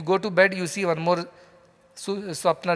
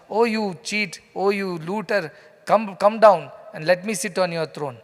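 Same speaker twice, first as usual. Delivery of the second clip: usual speaking voice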